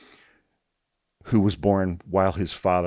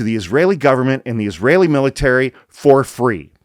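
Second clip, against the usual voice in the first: loud, high, impassioned outrage; worked up; 5 semitones higher, 8 dB louder than usual. Real, not acted. Commentator decrying weapons sent to Israel, worked-up and outraged. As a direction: hammer it out in loud, real anger. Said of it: "I get a little worked up".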